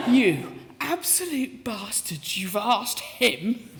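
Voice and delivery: theatrical huffy complaint, slow and rising; mock indignation. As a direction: ham up being wronged. Mock outrage at being overlooked.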